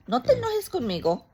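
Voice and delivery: high loud cooing in Spanish; pleading baby talk to dog. Acted. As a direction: beg the dog not to be mad.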